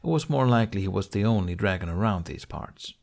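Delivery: plain reading voice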